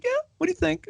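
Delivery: disarming. Retold or imitated. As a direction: toss it off lightly, totally in control. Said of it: "she's very disarming that way".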